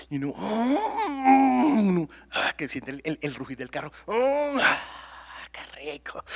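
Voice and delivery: hammed-up bliss at the roar of the engine; mock pleasure moan. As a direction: driver savoring speed with mock ecstasy. Savoring speeding, mock pleasure.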